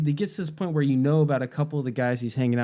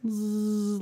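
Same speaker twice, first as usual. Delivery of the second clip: buzzing jet noise; 6 semitones higher, 4 dB quieter than usual. Retold or imitated. Mimicking approaching fighter plane.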